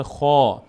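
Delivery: "خا" with a full, back, long "aa"; heavy letter. Shown as a demonstration. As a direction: open the mouth upward on a long "خا". («خا» پر و کشیده)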